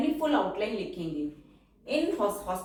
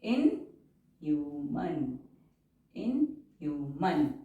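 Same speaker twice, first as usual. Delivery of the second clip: slow dictation; 6 semitones lower, much slower than usual. Shown as a demonstration. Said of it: "N के लिए हम stroke बनाएंगे".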